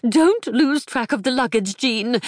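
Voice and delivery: put-on high, panting old lady; breathless panic. A narrator's reading. Fretful mother panting warnings at her daughter Jean. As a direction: gasp it out between pants. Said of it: "managed between pants".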